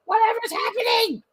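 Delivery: very high squeaky alarm; startled truck squeal. A narrator's reading. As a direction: ask in a tiny panicked squeak.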